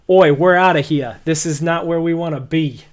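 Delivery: loud, hasty bail-out shout; panicked guardsmen. Acted. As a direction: yelp it and bolt. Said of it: "They're like".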